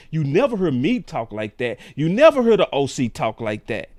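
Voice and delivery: ordinary talk, nothing marked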